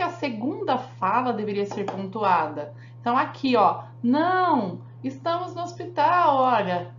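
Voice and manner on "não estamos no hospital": "Não" set apart firmly, then "estamos no hospital"; pause after "Não". Shown as a demonstration. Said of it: "tinha que ter uma vírgula aqui".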